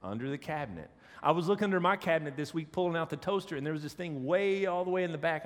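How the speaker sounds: normal talking voice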